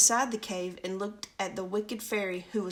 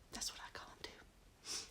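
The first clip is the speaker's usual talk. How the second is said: put-on whispered little girl line; 20 dB quieter than usual. Little girl agreeing with Clara, whispered. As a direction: breathe it as a secret. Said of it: "said the little girl".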